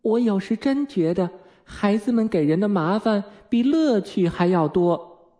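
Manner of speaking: high, weary mother voice; high-pitched motherly complaint, slowing at the end; exasperated mother. A narrator's reading. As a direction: sigh over the children's trouble.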